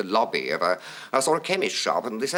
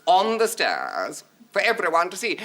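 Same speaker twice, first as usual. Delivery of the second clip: high, gushing gossip voice; high posh lady confiding; 5 semitones higher, 4 dB louder than usual. Retold or imitated. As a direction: play a breathless bus queue gossip. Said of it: "this woman in the bus queue was saying".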